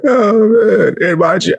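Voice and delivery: ordinary talk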